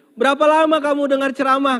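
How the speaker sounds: very high, loud comic voice; comic high question. Acted. Husband quizzing the jinn, high and loud.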